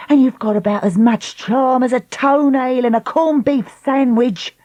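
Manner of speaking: high, jeering questions; mock him hard; taunting. Lucy's taunting interrogation.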